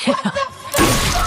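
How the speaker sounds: a high burst of shrieking laughter. Real, not acted. Recapping the movie to viewers, shrieking laugh. Laughing at kid getting shot.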